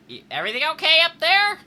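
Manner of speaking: high, loud, quick call upstairs. Acted. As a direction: call up to someone on another floor. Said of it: "kinda calls out".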